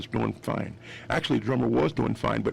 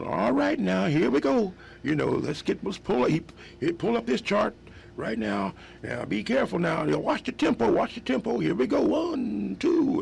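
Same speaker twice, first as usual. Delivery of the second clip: much higher, bright bandleader count-in; 10 semitones higher than usual. Retold or imitated. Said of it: "he's going".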